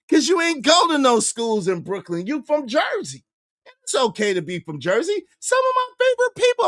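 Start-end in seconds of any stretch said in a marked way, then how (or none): 5.4-6.7 high fast aside. Acted. Sudden high fast shift.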